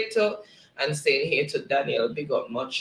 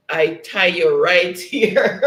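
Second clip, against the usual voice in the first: louder, drawn out, breaking into laughter; amused laughing; 3 semitones higher, 8 dB louder, slower than usual. Real, not acted. Host reading chat banter aloud, breaking up laughing. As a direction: let the last words tip into a real laugh.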